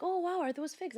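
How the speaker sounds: light mock excitement at the fig tree; excited wonder. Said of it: "she was like".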